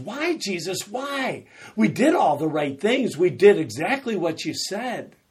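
high, loud bewildered protest; confused pleading. Retold or imitated. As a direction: plead with Jesus, baffled. Confused disciples questioning Jesus.

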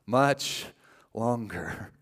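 fading to a broken whisper; desperate prayer. Retold or imitated. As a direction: plead with God, ending in whisper. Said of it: "we just say".

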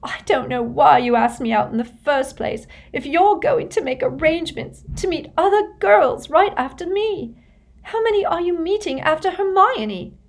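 high, breaking, tear-choked; crying. A narrator's reading. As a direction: spit it out through crying. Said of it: "now crying into the napkin".

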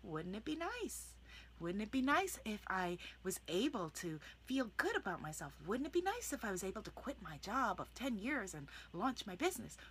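quiet, whispered wondering; gently dream it aloud; soft wishful musing. Shown as a demonstration. Coach modelling softened affirmations, quiet and wishful. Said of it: "because you're just saying".

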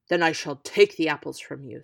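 gruff giant threat; menacing threat. Acted. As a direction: seize them by force.

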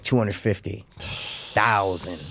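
low, quiet villain imitation; a hint of a sinister movie character; quoting the villain. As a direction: drop low and soft to mimic the rich villain. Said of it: "He's like".

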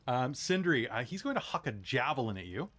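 usual speaking voice

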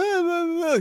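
put-on high slurred drunk noises; drunken babble. Bar heckler drunkenly interrupting his set, high slurred babble. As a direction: mimic a drunk guy slurring. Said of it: "drunkenly say something".

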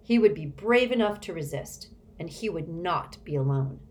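ordinary delivery

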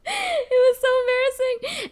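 squealed through laughter, very high and loud; slow, high-pitched embarrassed laughter; helpless laughing. Real, not acted. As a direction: barely get it out for laughing. Laughing through retold embarrassing moment.

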